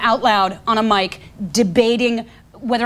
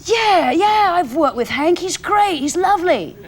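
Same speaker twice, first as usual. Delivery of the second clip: higher-pitched, put-on English praise; lilting mock British caller voice; Eddie Izzard; 5 semitones higher, slower than usual. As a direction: imitate an English luvvie on the phone. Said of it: "Hank as Eddie Izzard says".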